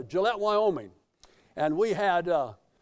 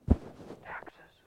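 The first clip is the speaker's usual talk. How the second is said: whispered word; 7 dB quieter than usual. Real, not acted. Confessing late taxes, hushed.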